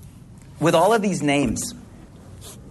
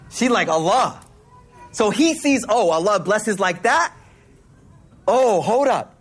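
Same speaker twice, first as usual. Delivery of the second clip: higher, animated storytelling; excited preaching; 7 semitones higher than usual. Acted. Preacher marveling at Maryam's fruit, excited and higher. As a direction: tell it with rising excitement.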